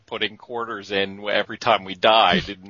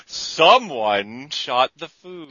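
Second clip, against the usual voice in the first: slow, drawn-out arcade game announcer imitation; Gauntlet; 3 semitones higher, much slower than usual. Game scolding its players, imitated announcer voice. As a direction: intone it like a talking arcade machine, very slowly. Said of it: "The game talked back to you".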